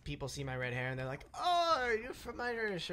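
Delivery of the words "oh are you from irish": high, drawn-out imitation of nosy strangers; mock eager surprise. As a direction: stretch a high, gushing "oh" as a mock question. Quotes people excited by red hair.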